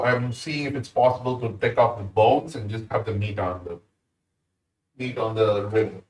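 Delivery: ordinary delivery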